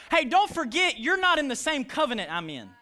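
high, loud mock challenge; acted calling out. Young David calling out the Philistine, loud acted challenge. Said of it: "You know what he was calling out?".

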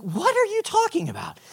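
high, hushed disbelief; baffled question. Retold or imitated. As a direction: ask it high and soft, puzzled. Baffled reply to worried friend.

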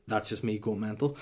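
ordinary talk

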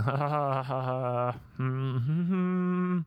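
stiff polite "ha ha", then high humming; polite fake laugh. Comedian mocking his own politeness, awkward fake laugh and high hum. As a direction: hum awkwardly instead of answering. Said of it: "I need to be less polite. Be like".

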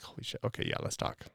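breathy whisper, relieved; whispered relief. Acted. Acting client's relieved reply.

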